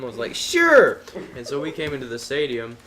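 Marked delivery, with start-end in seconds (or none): 0.3-1.0 very high, loud, excited yelp; over-the-top enthusiastic acceptance; eager yes. Retold or imitated. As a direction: jump at the invite with a big squeal. Acted eager reply to invitation.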